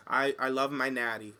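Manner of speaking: ordinary delivery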